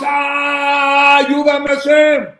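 loud, high cry for help to God; desperate cry. Retold or imitated. Woman in pit cries to God.

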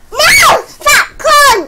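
high, loud outburst; angry yelling. Real, not acted. Player to an interfering sister, angry yell. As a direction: lash out, voice shooting up.

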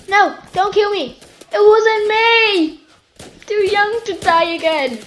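playful high-pitched protest; mock pleading. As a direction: plead in hammed-up panic. Mock begging for life in game.

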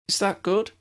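put-on high, curious girl's voice; high young girl voice. Young girl puzzled question.

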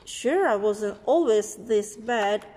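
ordinary delivery

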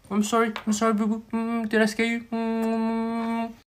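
put-on high, babyish cooing; apologetic baby talk. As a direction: soothe a scared sweetheart in baby voice.

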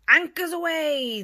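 high, loud pirate call; captain's cry. Acted. Pirate captain crying out to his crew. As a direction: bellow it to the crew. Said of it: "the captain cries".

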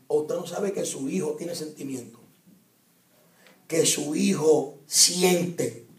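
ordinary delivery

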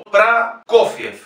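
Prokofiev slowly as "pra-KO-fyef"; A then stressed O. Shown as a demonstration. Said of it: "tiene la sílaba tónica y es O".

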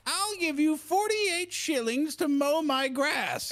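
slow, high-pitched parody voice; mock joke character. Friend parodying the goat in a bar joke, slow high mock voice. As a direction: stretch it out as a silly punchline. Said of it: "and says".

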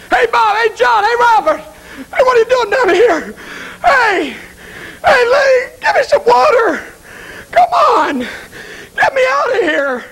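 high, wailing cries for help; tearful, high-pitched pleading shout; desperate yelling. Acted. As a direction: call out name after name, begging from afar. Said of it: "He just yelled for you".

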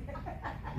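a low breathy mocking laugh; demon laugh. The demon mocking the believer, a low breathy laugh. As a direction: let the demon chuckle at you. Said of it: "that demon began to manifest".

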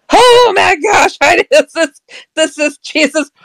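high-pitched, overwhelmed gasp of disbelief, retold; freaking out. Woman realising who stands before her, freaking out. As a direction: act out freaking out, voice leaping way up. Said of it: "freaking out".

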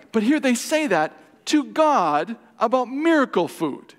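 preaching emphasis. Real, not acted. Preacher to congregation, about ungrateful Israel, rising outrage. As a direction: lean into the outrage, slow and loud.

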